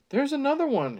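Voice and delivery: high, urgent narrated gasp; gasped alarm. A narrator's reading. Character spots another worm, gasping in alarm. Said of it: "he gasped".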